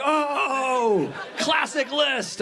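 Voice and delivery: high, slow shouted cheer; mock crowd cheer. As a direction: hype up the joke like a whole room. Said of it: "Everybody was like".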